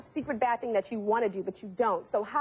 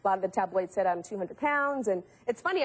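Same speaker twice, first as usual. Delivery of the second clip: ordinary talk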